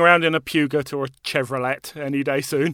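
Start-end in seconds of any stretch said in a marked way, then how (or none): 0.3-2.0 Puget said "pew-gut", Chevrolet "chev-ro-let"; spelling pronunciation. Shown as a demonstration. Co-host mocks the host's rule, Puget and Chevrolet said as spelt. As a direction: sound every final t in "Puget" and "Chevrolet". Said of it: "we just have to pronounce it the same".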